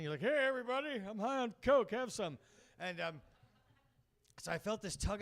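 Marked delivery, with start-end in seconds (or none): 0.2-2.4 high, loud, goofy put-on voice; coked-up funny talk. Coked-up user greeting everybody, mock funny high voice. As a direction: announce it to the room, hammed-up and wired. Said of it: "you just get coked up and you talk funny".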